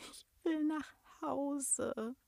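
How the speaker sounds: high, slow, tearful whimper; light sobbing. A narrator's reading. Lost girl near tears longs for home, lightly sobbing. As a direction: start to sob softly, drawing the words out. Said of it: "begann sie leicht zu schluchzen".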